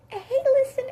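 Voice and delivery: very high, loud squeak; badly pitched high boy voice; squeaky high voice. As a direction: pitch it way up, cartoonish. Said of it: "That was really bad".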